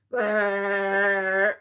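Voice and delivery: long loud growling burp; bear-like growl. Acted. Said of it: "like a bear growling".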